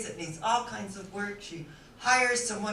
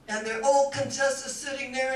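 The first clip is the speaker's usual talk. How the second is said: normal talking voice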